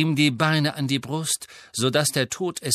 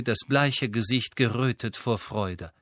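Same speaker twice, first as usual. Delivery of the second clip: ordinary delivery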